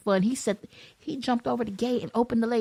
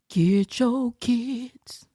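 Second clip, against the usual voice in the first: very slow, deliberate order; slow, emphatic telling-off; drawn-out command; much slower than usual. Acted. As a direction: stretch each word out slowly. Mock plea to Toya.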